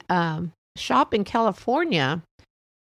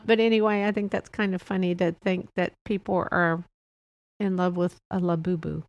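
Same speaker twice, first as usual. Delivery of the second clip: ordinary talk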